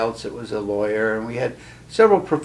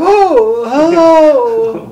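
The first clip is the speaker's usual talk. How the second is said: very high, loud and strained, with odd wailing intonation; deaf man's voice; 13 dB louder, much slower than usual. Retold or imitated. Imitating the deaf hypnotist greeting a young student, high and wailing. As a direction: mimic a stone-deaf man who can't hear his own pitch. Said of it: "he had a very strange intonation to his voice".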